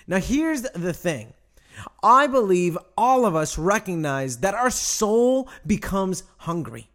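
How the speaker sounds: ordinary talk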